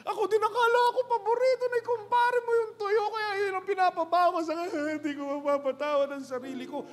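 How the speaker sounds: second widow confessing to her friend; hammed-up weeping. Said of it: "iyakan silang dalawa".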